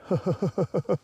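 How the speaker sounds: high, rapid giggly chuckle; chuckling. Real, not acted. Angler amused by Creel's bird watcher tease, chuckling. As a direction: chuckle in quick even pulses.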